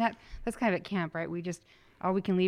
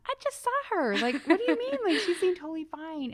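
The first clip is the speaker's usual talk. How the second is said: high, loud incredulous protest; comically puzzled, high-pitched objection; mock bewilderment; 12 semitones higher, 6 dB louder than usual. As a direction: retell it as baffled disbelief, pitch shooting up. Retells own puzzled reaction to prayer request.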